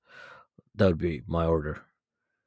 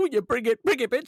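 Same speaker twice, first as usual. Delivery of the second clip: high-pitched mocking challenge; squeaky taunt.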